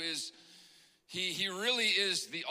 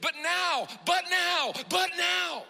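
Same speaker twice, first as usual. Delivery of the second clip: "but now" repeated, each take louder and higher until shouted; building shout; 12 semitones higher, 7 dB louder, slower than usual. Shown as a demonstration. Pastor rousing the congregation, "but now" climbing to a shout. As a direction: hammer "but now" harder every time until it is a shout. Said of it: "This is a but now moment".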